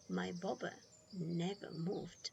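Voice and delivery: ordinary delivery, nothing marked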